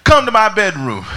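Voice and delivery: father. Retold or imitated. Father summoning son.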